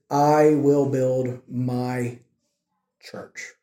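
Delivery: "I will build my church" drawn out word by word, pause before "church"; emphatic. Real, not acted. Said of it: "the reason why I'm emphasizing that".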